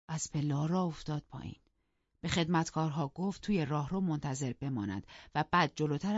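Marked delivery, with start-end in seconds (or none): none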